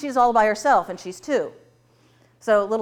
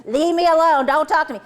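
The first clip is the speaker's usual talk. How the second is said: high, loud, hurt snap; acted spousal outburst; angry rebuff; 7 semitones higher, 7 dB louder than usual. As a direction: push them away loudly, pitched up. Acted marital quarrel, rebuffing an apology.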